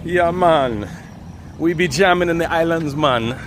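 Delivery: drawn-out mock Caribbean "Yeah, man"; terrible Caribbean accent. Vlogger jokes about jamming in the islands, terrible Caribbean accent. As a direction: put on a hammed-up, bad Caribbean accent. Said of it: "that's my terrible Caribbean accent".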